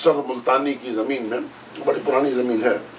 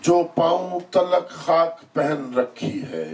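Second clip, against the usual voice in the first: measured, higher declaiming voice with long-held line endings; Urdu poetry recitation; 6 semitones higher, slower than usual. Acted. Poet reciting a ghazal to a mushaira audience, declaimed. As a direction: recite the couplet, pausing for the audience.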